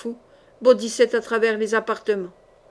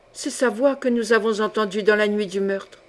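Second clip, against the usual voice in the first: usual speaking voice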